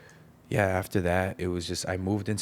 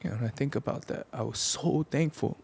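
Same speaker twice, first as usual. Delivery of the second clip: real voice breaking, pitched higher; choked up; 7 semitones higher than usual. Real, not acted. Son recalling the reconciliation with his dad, choked up with gratitude. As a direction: push the words out through rising tears. Moved to tears telling reunion.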